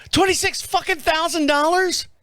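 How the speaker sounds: high, incredulous squawk at the price; shocked outburst. Retold or imitated. Wife hears the price, shocked high outburst. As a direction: play the wife reeling at the number.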